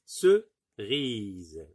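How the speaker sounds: "Cerise" as a punched ce, pause, long rise; syllable by syllable. Shown as a demonstration. Teacher showing there are two syllables, "Cerise" split apart. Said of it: "que deux syllabes".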